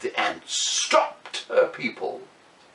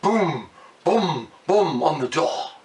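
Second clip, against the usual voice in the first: usual speaking voice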